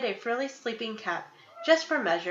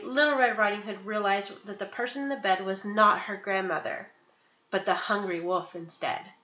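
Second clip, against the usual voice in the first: ordinary delivery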